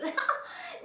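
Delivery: very high laugh; high giggle. Acted. Godmother after the sneakers, high giggle. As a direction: giggle with glee.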